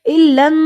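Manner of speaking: الن with the final n held long in a hum; nasal ghunnah. Shown as a demonstration. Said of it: "نون پہ غنہ ہوگا".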